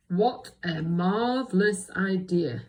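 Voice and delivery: deep wise voice, excited; loud, slow and grand old character; Bramwell. Shown as a demonstration. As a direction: proclaim it slowly with weighty delight. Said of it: "in his deep, wise voice".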